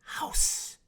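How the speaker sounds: hissed "House" with no voice. A narrator's reading. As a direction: breathe the word out as a hiss. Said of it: "hissed the mermaid".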